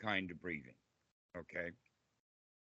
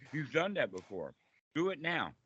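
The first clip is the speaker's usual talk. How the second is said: ordinary talk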